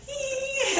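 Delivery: squeaky playful hee, very high; tiny high giggle. Retold or imitated. Mimics a little giggle at him.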